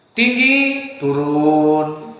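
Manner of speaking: high on Tinggi, dropping low on turun; sing-song pitch demo; demo high then low. Shown as a demonstration. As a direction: show the rise and fall of a tearful prayer. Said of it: "membuat nangis dengan sistem intonasi".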